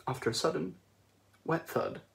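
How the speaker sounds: ordinary delivery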